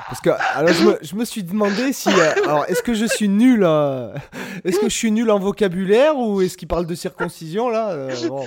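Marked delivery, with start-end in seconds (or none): 0.7-7.9 high, laughing confusion; incredulous half-laugh; laughing disbelief. Real, not acted.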